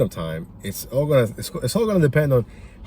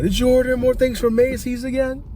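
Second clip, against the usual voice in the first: retold yell. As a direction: imitate a husband yelling to his wife in another room. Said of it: "he yells inside".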